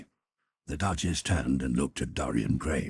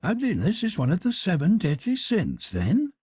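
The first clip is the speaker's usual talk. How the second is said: arch, teasing noblewoman, pitched up; high Duchess voice; 7 dB louder than usual. Acted. Duchess challenging Lord Henry, high and teasing. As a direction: play the witty Duchess, high and bright.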